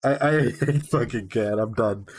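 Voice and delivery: real, broken-up laughter between the words; overwhelmed, giggling through it; laughing not to cry. Real, not acted. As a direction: laugh helplessly to hold back tears. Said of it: "I'm laughing because I'm trying not to cry".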